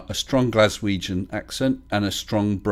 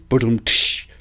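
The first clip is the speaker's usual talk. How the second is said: imitated drum sting; spoken rimshot; 4 dB louder than usual. Shown as a demonstration. Host following own Beatles pun, mock rimshot. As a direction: punctuate your pun with a vocal cymbal crash.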